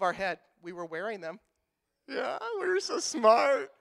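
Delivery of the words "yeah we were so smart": slow, higher-pitched goofy voice; hammed-up self-mocking dopey boast; mock smug dimwit. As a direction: drawl it out, sarcastically proud of yourself. Mocking his younger self's cleverness.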